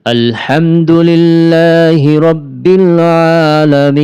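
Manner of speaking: slow melodic tajweed chant; Quran recitation. Acted. Teacher recites the opening verses, slow melodic chant. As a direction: intone each verse, holding the long vowels.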